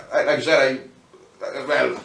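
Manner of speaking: ordinary talk, nothing marked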